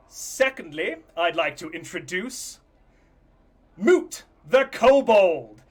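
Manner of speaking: loud, high announcer voice; showman host announcing. Acted. The host presents the judges to the audience, booming. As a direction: present the judges with big showbiz flair.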